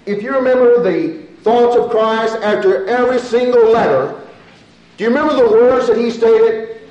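high, forceful scripture proclamation; preach. Real, not acted.

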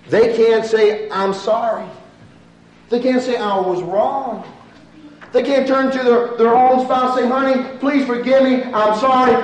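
loud, high, fervent preaching; preaching at full force. Acted. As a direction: drive the plea home to the congregation.

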